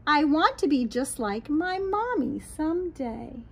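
lilting, sing-song storybook read; reading to children. A narrator's reading. Teacher reading a picture book to children, lilting. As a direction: read it aloud to little ones, bright and rhythmic.

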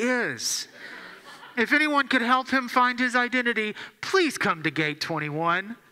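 a formal, mock-polite airport page; intercom announcement. Gate agent paging the whole terminal about the rude man, mock-formal announcement. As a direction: announce it over the PA with dry sarcasm.

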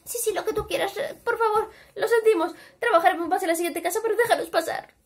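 high, fast, laughing-crying plea; high whiny pleading. A narrator's reading. The pigs beg their brother to let them in, high and whiny. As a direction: grovel to get inside.

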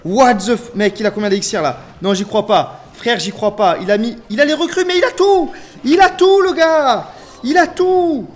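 shouting in disbelief, climbing to very high yells; outraged disbelief. Real, not acted. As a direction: build from a loud stunned protest to full screaming. Stunned by opponent's endless cards.

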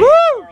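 loud, high "woo"; high-pitched cheer; cheering whoop. Real, not acted. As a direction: whoop in celebration. Crowd cheers the speaker.

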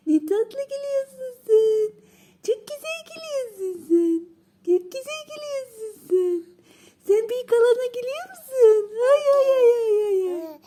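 put-on very high, sing-song cooing at a baby; high-pitched baby talk to a giggling grandson. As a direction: coo up high like you're delighting an infant.

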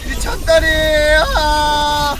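loud thrilled shouting; excited cheer. Real, not acted. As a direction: whoop like you just clutched. Celebrating big play.

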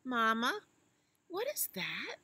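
high, curious little elephant; high child voice. Acted. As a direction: ask like a wide-eyed calf.

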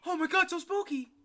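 high, loud mock-spooked squeal; excited shriek. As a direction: shriek it out in fake fright. Mock scream at spooky game warning.

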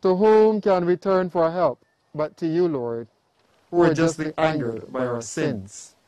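usual speaking voice

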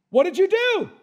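loud, high demanding question; stern confrontation. Acted. God confronting Adam after the fruit, stern and loud.

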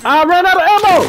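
high loud yell; panicked shout. Real, not acted. Panicked shout mid firefight.

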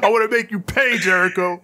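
high, fake-sob promise of revenge; mock crying threat. Podcaster mocking Eddie's teary threat to Jericho, hammed-up sob. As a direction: ham up a tearful vow.

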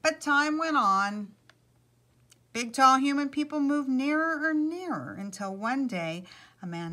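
animated, projected storyteller voice; lively picture-book reading; story narration. A narrator's reading. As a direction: read it aloud to an audience, lifting key phrases. Story read to listeners.

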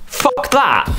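ordinary talk

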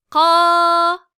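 Ka from the back of the mouth, high and loud; deep full Ka. Shown as a demonstration. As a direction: strike Ka full from the tongue root. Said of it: "dil kökünden yani ağzın en gerisinden kalın ve tok bir sesle".

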